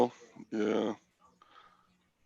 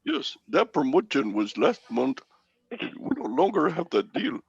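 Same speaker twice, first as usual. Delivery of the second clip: put-on higher Indian-accented agent voice; imitated Indian accent; 4 semitones higher than usual. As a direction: play the agent breaking the bad news in an Indian accent. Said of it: "you could do that accent".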